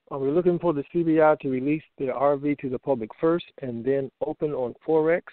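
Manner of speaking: ordinary talk, nothing marked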